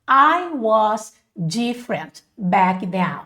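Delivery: slow, careful English demonstration; slow model sentence. Shown as a demonstration. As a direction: give each word room for learners. Models sentence after pronunciation correction.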